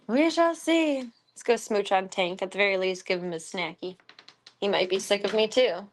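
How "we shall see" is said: higher, louder, slow mock-grand "We shall see"; hammed-up dramatic reply; sing-song. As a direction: drag it out like a playful pronouncement.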